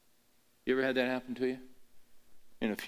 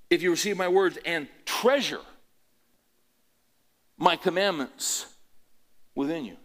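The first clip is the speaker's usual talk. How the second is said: fervent scripture reading; 7 semitones higher, 7 dB louder than usual. Acted. Quoting Proverbs fervently.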